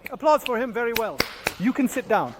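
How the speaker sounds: normal talking voice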